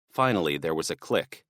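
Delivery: plain reading voice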